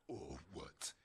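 low, quiet tough-guy voice; deep voice. Retold or imitated. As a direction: drop into a low bully growl. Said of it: "you deep voice".

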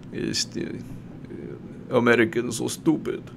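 breathy sneering aside; mock Putin whisper. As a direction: hiss it like a smug villain. Voicing Putin mocking Americans.

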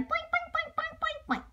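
very high boing sounds; playful boing. Acted. Bunnies hopping, very high playful boings. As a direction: bounce the voice like a spring.

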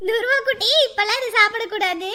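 normal talking voice

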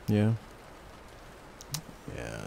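ordinary talk, nothing marked

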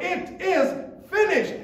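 "finished" cried out high and loud in victory; triumphant cry. Retold or imitated. Christ crying out his victory from the cross. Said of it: "shouted with a loud cry".

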